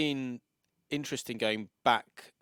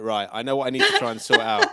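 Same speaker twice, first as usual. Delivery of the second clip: laughing; 9 dB louder than usual. Real, not acted. Laughing, retelling own reaction.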